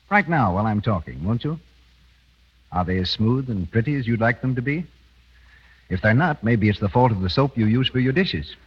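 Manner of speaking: usual speaking voice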